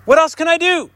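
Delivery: high-pitched exasperated cry; desperate, pitched far above normal; crisis outcry. Acted. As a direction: cry out as if at wit's end. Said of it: "This is a crisis".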